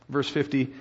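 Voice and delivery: usual speaking voice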